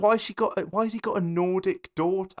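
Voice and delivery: high-pitched incredulous questioning; retold bafflement. Viewer puzzled by the daughter's accent, high mock bafflement. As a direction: ask it high, as if utterly baffled.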